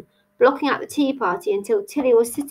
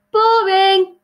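high, loud jeer; drawn-out heckling shout; 11 semitones higher, 7 dB louder than usual. A narrator's reading. Hare heckles shy Tilly, shouting. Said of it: "shouted the Hare".

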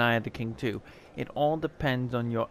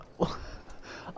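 breathless giggle; 8 dB quieter than usual. Real, not acted. Breathless laugh after winning.

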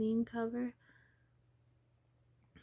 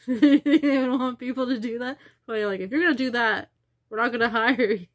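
much louder, laughing through the words; laughing loudly; 15 dB louder than usual. Real, not acted. Mocking herself as a nosy cashier, loud and laughing. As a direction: laugh it out while telling them off.

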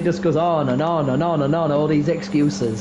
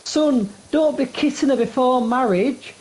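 mother's voice; 8 semitones higher than usual. Retold or imitated. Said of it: "he's like".

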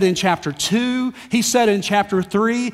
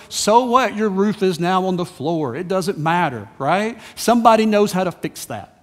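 normal talking voice

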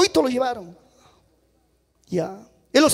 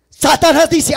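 normal talking voice

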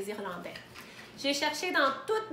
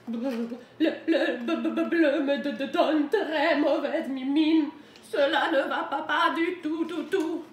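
stuttering, shivering storybook wolf; hammed-up freezing stammer, raised pitch; teeth chattering; 3 semitones higher, 6 dB louder than usual. A narrator's reading. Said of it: "En claquant des dents". (en claquant des dents, grelottant)

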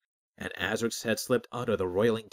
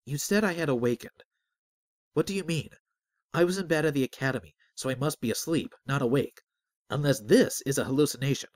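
higher-pitched, agitated protest; disbelieving objection in a raised pitch; arguing; 5 semitones higher, 4 dB louder than usual. A narrator's reading. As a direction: argue back, confused and insistent. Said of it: "Azric argued".

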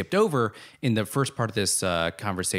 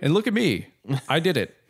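mock boast; 5 semitones higher, 4 dB louder than usual.

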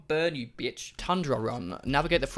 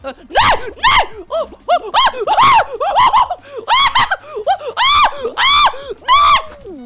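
very high, loud wailing no's; frantic shrieks of defeat; anguished screaming; 14 dB louder, much slower than usual. Real, not acted. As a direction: scream in helpless disbelief. Falls out of the game.

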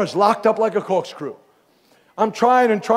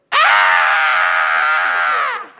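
startled cry; 14 semitones lower, 4 dB louder than usual. Retold or imitated. Girl's ear pops open.

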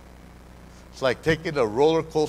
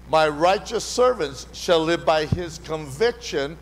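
ordinary delivery

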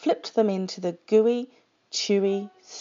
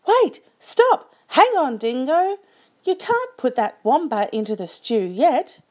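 high, loud panicked shouting; urgent shout; 6 semitones higher, 4 dB louder than usual. Acted. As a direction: yell to stop him. Wombat calls out urgently.

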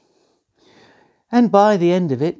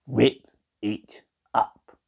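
low menacing; 4 semitones lower, 3 dB quieter, much slower than usual. A narrator's reading. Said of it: "looked really angry".

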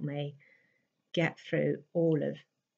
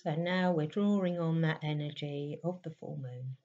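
guided meditation; 4 semitones higher than usual. Real, not acted. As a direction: gently guide them inward, leaving space between lines.

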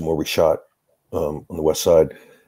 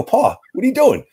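much higher, louder teasing call to Pa; cheerful greeting to Pa; 5 dB louder than usual. Retold or imitated. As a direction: pop your head in and tease the grumpy old man. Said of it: "I go".

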